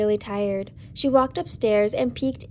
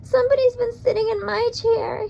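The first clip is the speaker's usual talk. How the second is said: very high squeaky little bear; high squeaky cub; 12 semitones higher than usual. A narrator's reading. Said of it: "he squeaked".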